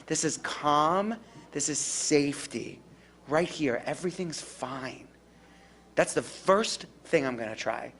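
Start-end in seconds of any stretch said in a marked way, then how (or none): 0.1-5.1 calm soothing demo. Shown as a demonstration. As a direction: show pure calm and safety. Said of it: "You can see it in my face. This is calm".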